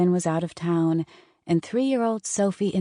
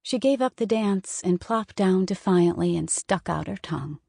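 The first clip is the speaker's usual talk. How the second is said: ordinary delivery, nothing marked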